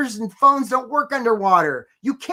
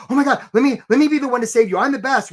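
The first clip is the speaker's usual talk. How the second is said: louder, higher, overeager sales pitch; needy eager rescuer; 5 semitones higher, 6 dB louder than usual. Retold or imitated. Needy guy in the boat pitching himself to the drowning swimmer, louder and overeager. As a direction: beg to be the hero, way too keen. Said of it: "they were like".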